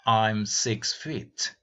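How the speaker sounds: drawn-out clear answer; slow model answer. Shown as a demonstration. Teacher slowly modelling the answer for students. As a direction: say each word slowly.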